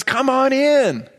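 high, drawn-out beckoning call; calling out invitation. Acted. Father calling his older son into the party, calling out. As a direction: call him in, stretching the words high.

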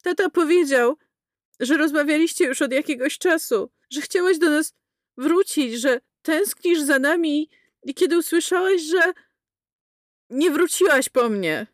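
put-on high, aggressive child's voice; boy's voice turning high and combative; choked then shrill boy. A narrator's reading. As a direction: build from choked to attacking. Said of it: "Jego głos ze zdławionego robił się wysoki i napastliwy".

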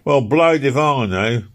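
Philpot's voice. Acted. Philpot answering Easton, slow and exasperated. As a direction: drag out the shrug of it.

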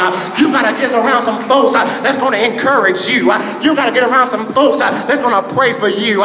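rally the church against the haters; preaching at full force; fired-up preaching. Acted.

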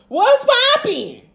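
high and slow, stretched out; drawn-out greeting. Pretend. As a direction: sing it out high and lazy. Greeting Bob playfully.

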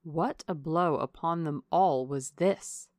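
usual speaking voice

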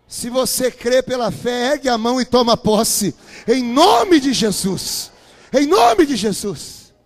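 loud, slow call to raise hands; slow booming invocation; fervent preaching. Real, not acted. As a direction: drive it home to the congregation. Preacher urging the crowd.

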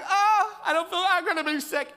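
put-on high, fast whiny child voice; sick kid. Son telling dad he'll be sick, high whine. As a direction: whimper like a sick little boy. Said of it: "He's like".

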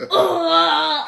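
loud drawn-out wailing "oh"; attempted scary voice. Shown as a demonstration. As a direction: try a scary groan that comes out loud and high. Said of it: "I can't do that".

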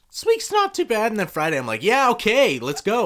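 high, loud burst of Friday cheer; acted excitement. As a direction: act out suddenly feeling pumped. Acting Friday's lifted mood.

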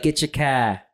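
put-on Batman with a thick Boston accent. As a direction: tough Boston guy, wicked smart. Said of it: "Batman with a Boston accent".

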